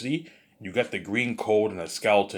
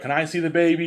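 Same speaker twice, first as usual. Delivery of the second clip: put-on higher and louder, like excited children asking; eager kids; 9 semitones higher, 6 dB louder than usual. The kids beg their dad to see the baby, higher and louder. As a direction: pitch up into an eager kid's plea. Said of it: "his kids are like".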